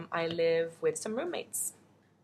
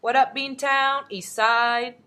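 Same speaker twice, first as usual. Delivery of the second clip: loud, higher hometown holler; playful shout-out; 6 semitones higher, 6 dB louder, slower than usual. Pretend. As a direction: call out a shout-out to the crew. Shout-out to aunt's city.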